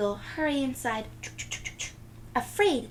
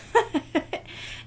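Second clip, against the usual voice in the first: breathy hearty chuckle; farmer's laugh; 7 semitones higher than usual. Acted. The farmer laughing at Nellie's question. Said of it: "The farmer laughs".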